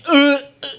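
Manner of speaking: loud, high mock pain grunts. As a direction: gasp out the pain of a stubbed toe. Falling from the toe pain.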